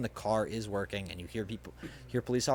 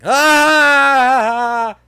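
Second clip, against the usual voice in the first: a longer, even louder high scream; 22 dB louder than usual. Acted. Hostage screams louder after being told to, all-out scream. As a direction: scream at the top of the lungs.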